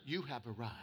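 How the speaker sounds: mock the sat-nav announcing "You have arrived"; GPS lady voice. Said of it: "my G P S lady".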